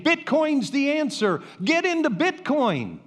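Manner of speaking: high, eager investor pitch; mock hype. Pastor mocks crypto investors to the congregation, hyped and high.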